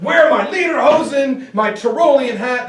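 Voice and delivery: hammed-up rally yell; mock shouting. Shown as a demonstration. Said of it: "when to scream and yell".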